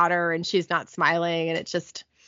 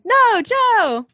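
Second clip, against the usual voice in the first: high, loud cry of protest; playfully hammed-up wifely yell; 13 semitones higher, 5 dB louder than usual. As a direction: yell it at your husband. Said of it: "yells at her husband".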